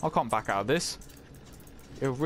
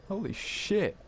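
shaken exclamation; 7 semitones higher than usual. Real, not acted. Still shaken after scare.

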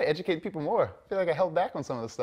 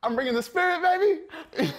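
hyped, high and loud cheer; excited shout; 6 dB louder than usual. Real, not acted. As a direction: bring the energy, yell it out. Accepting award, shouting excitedly.

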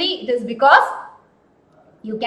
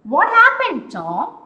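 Sid asking; 7 semitones higher, 6 dB louder, slower than usual. Acted. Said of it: "Sid asked".

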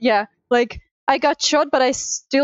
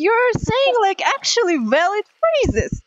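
high-pitched, swooping disbelief; astonished and high; real amazement; 9 semitones higher than usual. Real, not acted. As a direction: marvel at it, voice shooting up. Amazed at friend's Russian.

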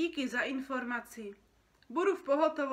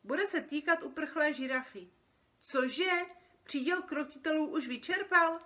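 loud, higher, businesslike phone call; brisk phone ordering; 7 semitones higher, 8 dB louder than usual. Acted. Dream worker ordering circus props by phone, brisk and loud. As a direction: rattle off an order down the line.

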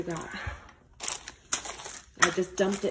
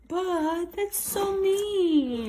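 mock hurt; 9 semitones higher, much slower than usual. Mom mock-hurt reply.

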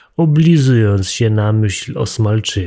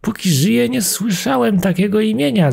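mouse can't believe it; much higher amazed little voice; astonished exclaim; 12 semitones higher than usual. A narrator's reading. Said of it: "zawołała mysz".

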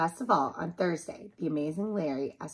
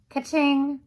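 high, loud "ka-ching"; imitated cash register ding; cash register sound; 9 semitones higher, 9 dB louder than usual. A narrator's reading. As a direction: ring it out like a till. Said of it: "went her cash register".

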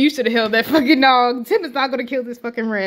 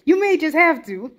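ordinary talk

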